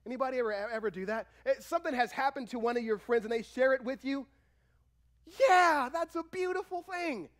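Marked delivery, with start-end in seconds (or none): 5.2-7.3 high, loud cheer easing off; exuberant, high-pitched celebration; acted rejoicing. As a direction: cheer for a friend's good news. Acts rejoicing at a friend's news.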